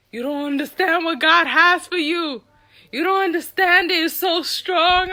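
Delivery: high, loud preacher's insistence; imitated pastor's fervor. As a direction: proclaim it like an overcome pastor. Imitates pastor's fervent exclamations.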